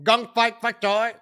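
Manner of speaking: Gong Fat Fat Choi said high, Fat doubled; mocking mispronunciation. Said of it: "You gotta say it like that".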